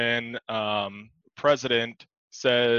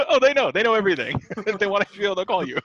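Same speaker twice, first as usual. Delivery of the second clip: mock Julius; 7 semitones higher, 4 dB louder than usual. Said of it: "he tells him".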